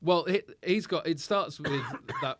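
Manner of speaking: normal talking voice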